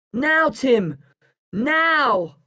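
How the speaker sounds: high, drawn-out signal call; shouting. A narrator's reading. Boy signalling his friend to pull ropes, shouting. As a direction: call the cue across a road. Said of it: "I shout".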